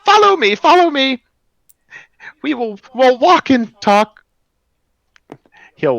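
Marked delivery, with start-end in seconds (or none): 0.0-4.2 very high, loud and cracking, an eager stone guide; high cracking golem greeter. Acted. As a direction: squeak it out high and loud like a crystal creature welcoming guests.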